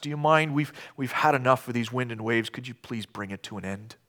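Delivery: soft, polite, unhurried asking; calm request. Retold or imitated. Disciples politely asking Jesus to stop the storm, calm. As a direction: ask nicely as if it's a small favor. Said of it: "a rather calm request".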